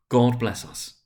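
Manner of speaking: ordinary delivery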